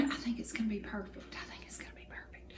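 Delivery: hushed, whispered aside; hopeful whisper. Real, not acted. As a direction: whisper it like a happy secret. Hopeful aside about the cobbler.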